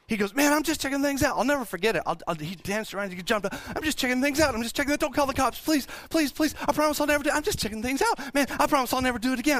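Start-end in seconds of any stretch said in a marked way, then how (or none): 0.3-1.4 high, quick mock excuse; frightened kid. Caught boy excusing himself to an older teen, imitated panic. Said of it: "He was obviously very frightened". 3.8-9.6 high, rapid-fire mock begging; frightened kid pleading. Caught boy begging not to have cops called, imitated panic. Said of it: "He was obviously very frightened".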